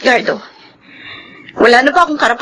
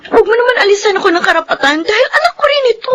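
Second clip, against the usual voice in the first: nagmamakaawang pagtutol; 10 semitones higher, much faster than usual. Acted. Away nina Maricel at Gardo.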